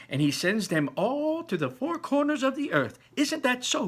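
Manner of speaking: high, eager grandmother voice; a grandma marvelling at the chocolate; old grandmother. Acted. Grandmother telling Charlie about Wonka, high and eager.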